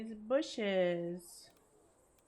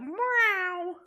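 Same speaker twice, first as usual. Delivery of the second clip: high, cutesy meow; high cat meow; 11 semitones higher, 7 dB louder than usual. Pretend. Pretending to be the cat.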